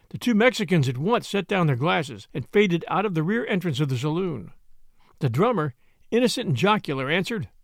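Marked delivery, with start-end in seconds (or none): none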